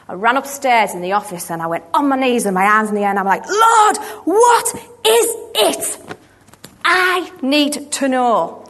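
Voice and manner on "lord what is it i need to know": loud, high, slow pleading cry; slow, much higher and louder desperate plea; desperate cry to God. Retold or imitated. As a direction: cry out to God with hands raised. Desperate prayer on her knees.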